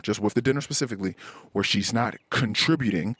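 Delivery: normal talking voice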